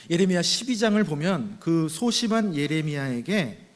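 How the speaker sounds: ordinary talk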